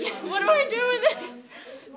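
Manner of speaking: very high, loud, slow puzzled voice; high drawn-out "what do I do with it?"; baffled cleaners. Retold or imitated. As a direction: play the confused cleaners.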